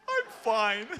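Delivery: high, laughing, choked-up I'm fine; laughing fake fine. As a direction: brush it off through laughter. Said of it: "I just wanna start crying".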